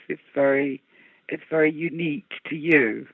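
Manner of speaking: normal talking voice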